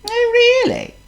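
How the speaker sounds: very high squeaky worm. Acted.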